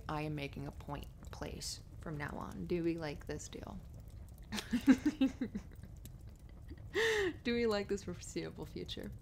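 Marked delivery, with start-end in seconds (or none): 3.8-7.4 long, high giggle; giggling. Real, not acted. Flirting on partner's lap, giggling. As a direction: giggle till you need a breath.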